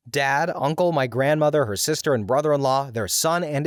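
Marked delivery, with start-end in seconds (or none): none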